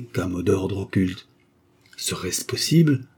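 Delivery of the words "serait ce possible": higher, astonished disbelief; stunned. A narrator's reading. Octave hearing of the secret enemy, stunned disbelief. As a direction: ask it as if the floor just dropped away. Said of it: "dit Octave stupéfait".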